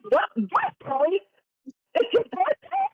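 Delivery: high, loud disbelieving rise; surprised question. Acted. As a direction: squeak it out in shock. Hare reacts in disbelief.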